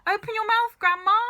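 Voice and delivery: put-on boy shouting to grandma to drink the water; cried out. A narrator's reading. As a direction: shout it over the smoke. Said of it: "he cried".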